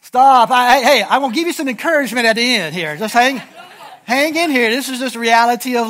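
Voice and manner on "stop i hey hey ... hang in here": normal talking voice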